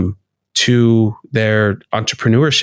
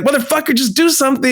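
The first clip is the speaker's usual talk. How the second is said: much higher, fed-up outburst at yourself; mock exasperation, pitched way up; exasperated inner voice; faster than usual. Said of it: "And I'll be like".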